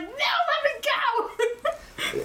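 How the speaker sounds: high, drawn-out yelled protest; hammed-up yell, close to laughing; mock yell. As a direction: playfully wail it high and long. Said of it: "[name]'s just yelling".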